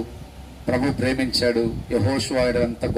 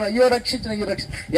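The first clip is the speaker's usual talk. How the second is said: crying for rescue; 9 semitones higher than usual. Acted. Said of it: "ఎంత కేకలేసి ఏడ్చినా".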